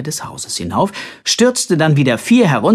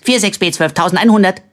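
high, racing string of numbers; rattling off rapidly; 6 semitones higher, 5 dB louder, faster than usual. A narrator's reading. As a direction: reel off the code at breakneck speed. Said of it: "ratterte Simpel in rasender Geschwindigkeit herunter".

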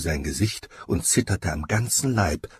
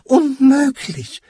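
high, loud, drawn-out exclamation; disbelieving cry; 8 dB louder, slower than usual. A narrator's reading. Giant refusing to believe his friends, disbelieving cry. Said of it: "rief der Scheinriese".

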